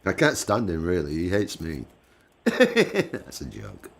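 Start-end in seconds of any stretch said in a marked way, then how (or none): 2.1-3.9 said through a laugh, high and slow. Real, not acted. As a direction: laugh the words out slowly. Joking after saying he hates me.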